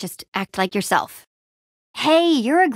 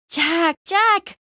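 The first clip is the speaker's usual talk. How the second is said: raised, higher-pitched call; 8 semitones higher than usual. Acted. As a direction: call the name to get attention. Calling out to get Jack's attention.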